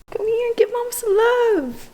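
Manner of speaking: high, loud sing-song pet voice. Real, not acted. Calling dog in pet voice.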